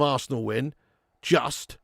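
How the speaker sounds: ordinary talk, nothing marked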